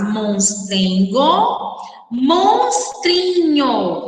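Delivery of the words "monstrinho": "monstrinho" stretched very slowly, each syllable separated; syllables drawn out. Shown as a demonstration. Teacher separating syllables for the children, monstrinho spelled out slowly. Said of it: "Separe agora as sílabas destas palavras".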